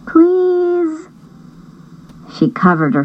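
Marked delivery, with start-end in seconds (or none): 0.1-1.1 put-on high pleading girl voice. Julie begging her mom to keep the chicks, high and pleading.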